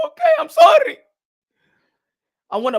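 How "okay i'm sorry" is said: high, loud yelled sorry; hammed-up shrieking apology; mock shouted apology. To angry fans, yelling a mock apology.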